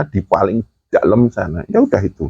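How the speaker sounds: usual speaking voice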